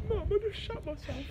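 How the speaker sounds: put-on high little kid voice; child voice. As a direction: whine like a small child. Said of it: "I was like".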